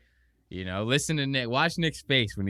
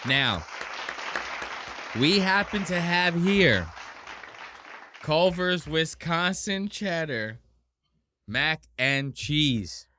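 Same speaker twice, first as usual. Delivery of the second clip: mock grand presentation; 4 semitones higher, slower than usual. Host presenting the Culver's mac and cheese to camera, slow grand reveal. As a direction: unveil it like a prize. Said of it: "presenting the dish".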